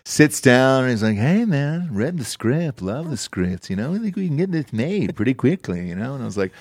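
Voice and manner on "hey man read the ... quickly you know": sing-song laid-back dude, swinging pitch; laid-back financier. Retold or imitated. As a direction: imitate a mellow movie-money guy. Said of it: "he talked like that".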